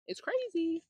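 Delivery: high, amazed exclamation; exclaiming, high. Real, not acted. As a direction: exclaim it in disbelief. Exclaiming about text costs.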